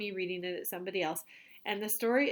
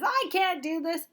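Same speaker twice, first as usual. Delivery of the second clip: mock complaint; 8 semitones higher, 5 dB louder than usual. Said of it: "what you can say instead".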